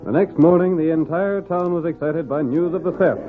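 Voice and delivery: ordinary talk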